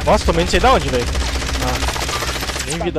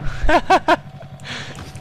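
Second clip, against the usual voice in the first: laughing; 13 semitones higher than usual. Real, not acted. Laughing at teammate's play.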